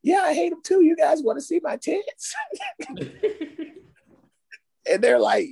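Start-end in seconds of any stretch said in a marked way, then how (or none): 0.0-2.3 high, sweet mock girl voice; high girly voice. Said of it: "And [name]'s like". 2.4-3.6 squealing laugh, high; high-pitched laugh. Real, not acted. Laughing at own impression.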